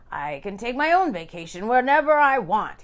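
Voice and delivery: a man delighted by his own idea; happily. A narrator's reading. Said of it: "he said happily now".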